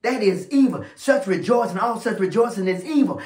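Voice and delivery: ordinary talk, nothing marked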